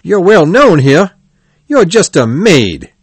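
loud, high-pitched scoffing; scornful ticket seller. A narrator's reading. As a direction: scoff at a self-important customer. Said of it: "The ticket seller laughed".

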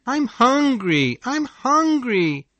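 put-on a hungry child begging; child crying out. A narrator's reading. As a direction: whimper it over and over. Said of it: "Wade kept crying out".

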